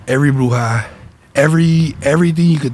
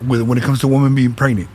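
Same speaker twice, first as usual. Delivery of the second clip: normal talking voice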